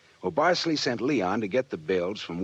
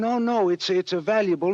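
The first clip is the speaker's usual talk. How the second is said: higher, louder, insisting fast; eager protest; 12 semitones higher, 6 dB louder than usual. Acted. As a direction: push the point with rising excitement.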